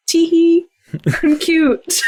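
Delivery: high, coy tee-hee; playful cutesy. Acted. She looks at her irritated colleague, playful cutesy. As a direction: act adorable to get out of trouble. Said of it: "a look like".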